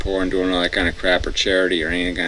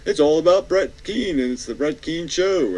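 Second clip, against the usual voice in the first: mock self-promoting host; 8 semitones higher, 4 dB louder than usual. Mocking Brett Keane's self-promotion.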